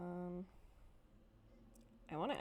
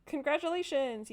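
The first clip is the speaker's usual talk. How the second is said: mock congratulations; 6 semitones higher, 7 dB louder than usual. Mock game announcement.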